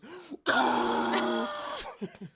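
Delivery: high sharp gasp into the mic; kid's loud gasp. Retold or imitated. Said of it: "and he goes".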